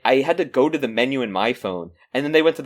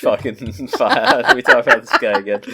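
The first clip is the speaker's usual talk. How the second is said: laughing exasperation; 2 semitones lower, 5 dB louder than usual. Real, not acted. Threatening to quit the pod over a repeated rant, laughing. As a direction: laugh helplessly while complaining.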